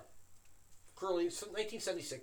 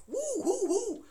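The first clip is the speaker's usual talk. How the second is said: high, loud rapid-fire disbelief; excited re-enacted astonishment; astonished; 8 dB louder, slower than usual. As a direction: demand it, voice leaping right up. Acts out his astonished questioning of friend.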